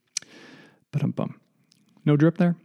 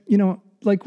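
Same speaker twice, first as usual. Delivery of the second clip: normal talking voice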